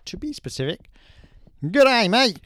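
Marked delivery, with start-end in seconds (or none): 1.6-2.4 higher, louder hammed-up Queensland Aussie; loud, high broad Australian accent. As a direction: ham up a broad Australian twang. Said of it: "I can do Australian, Queensland".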